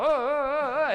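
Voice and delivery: loud, high "oh" chant; mock performer's whooping. Slave entertainer performing for the king, mock whooping.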